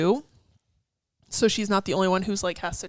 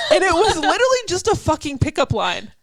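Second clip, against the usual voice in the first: high, loud, amused disbelief; telling a friend about a fortune cookie, loud and laughing; excited; 8 semitones higher, 7 dB louder than usual. Real, not acted. Telling the friend about a cheesy fortune cookie, high and loud.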